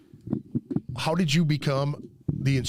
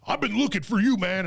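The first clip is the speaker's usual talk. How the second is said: higher-pitched accusing quote; retold confrontation; 8 semitones higher than usual. Friend retells Glenn coming in looking for him, accusing. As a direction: imitate an aggrieved man confronting you.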